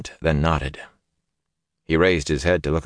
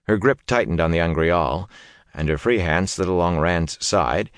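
ordinary delivery

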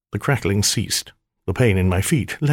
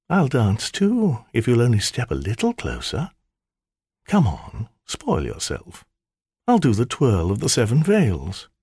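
teasing temptress; 3 semitones higher than usual. Acted. Spirit tempting the magician out of his circle, coaxing. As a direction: dangle the offer playfully. Seductive maiden voice.

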